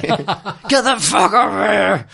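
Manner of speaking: high, slow, drawn-out yell; mock scream. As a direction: yell at a dog like a furious woman. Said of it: "a woman was screaming at it".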